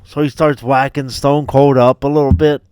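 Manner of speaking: ordinary talk, nothing marked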